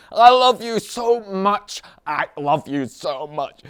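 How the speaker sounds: very high, loud and slow swooning; hammed-up lovesick gush. Teacher mocking a lovesick emotional type, slow swooning gush. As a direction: stretch it out like a besotted lover.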